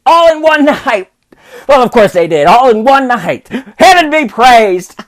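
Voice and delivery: put-on high, giddy old miser full of wonder; a reborn old man who can't believe his luck; overjoyed old Scrooge. Scrooge wakes on Christmas.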